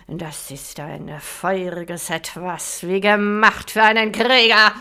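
barbarian. Acted. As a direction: relish the meat like a warrior. Warlord tastes the meat.

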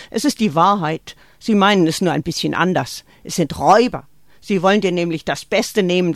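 a mother warning her daughter against people who want her best; Greta warning. Acted. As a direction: drum it into the child.

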